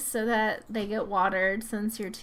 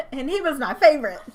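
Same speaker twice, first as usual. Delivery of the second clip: shocked cry; 6 semitones higher, 7 dB louder than usual. Real, not acted. Favourite Sim just died in game.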